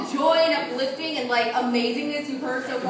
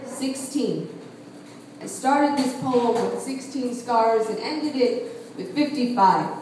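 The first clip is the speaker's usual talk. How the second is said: solemn spoken-word poem; 5 semitones lower, 4 dB quieter than usual. Acted. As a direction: let each phrase settle before the next. Poem winds down.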